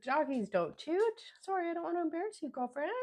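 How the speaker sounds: put-on high, sing-song baby voice for the dog; baby talk to dog. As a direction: coo at the dog like a toddler. Talking to her dog Freya.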